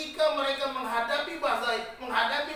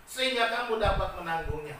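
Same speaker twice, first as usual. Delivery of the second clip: preaching at full force; 3 semitones higher, 5 dB louder than usual. Acted. Preacher proclaiming the verse to the congregation, full force. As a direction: proclaim God's promise like a revival preacher.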